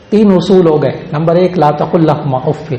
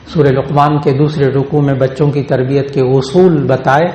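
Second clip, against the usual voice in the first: ordinary talk, nothing marked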